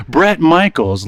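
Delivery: ordinary talk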